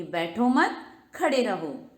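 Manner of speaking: बैठो मत joined, break, then खड़े रहो; pause after मत. Shown as a demonstration. Teacher repeats the order to stand, pause after मत. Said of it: "खड़े होने के लिए कहा जा रहा है".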